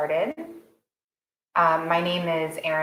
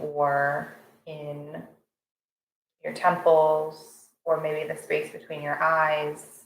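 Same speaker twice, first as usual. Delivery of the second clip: guided meditation. Real, not acted. Instructor settling a morning class into breath awareness, calm guided meditation. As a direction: guide them gently into the breath, unhurried.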